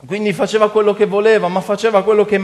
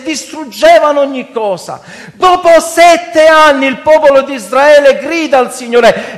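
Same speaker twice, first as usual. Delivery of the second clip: impassioned, raised-pitch sermon; preaching; 6 semitones higher, 6 dB louder than usual. Real, not acted. Preacher asking brothers why they wait to cry out, preaching. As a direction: challenge the congregation with full force.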